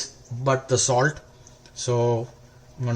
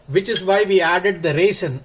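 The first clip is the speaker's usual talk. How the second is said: ordinary talk, nothing marked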